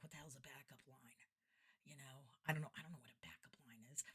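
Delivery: very quiet, whispered aside; hushed bafflement. Retold or imitated. Baffled entertainer to herself over tech jargon, hushed. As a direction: mutter it under your breath in confusion.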